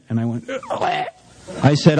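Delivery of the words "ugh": very high strangled "ugh"; mock squeal of dismay. Reacting to the boss's rebuff, high strangled squeal. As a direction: squeeze out a tiny, high yelp.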